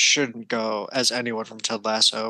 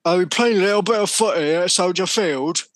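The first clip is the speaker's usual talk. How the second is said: put-on British accent; 6 semitones higher than usual. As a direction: ask it like a British football fan. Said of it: "speaking of that accent".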